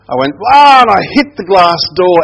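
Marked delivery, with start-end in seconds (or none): 0.5-0.8 high, loud acted "aah!"; startled yell. Storyteller knocked into the glass door, startled mock yell. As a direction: cry out as if suddenly shoved.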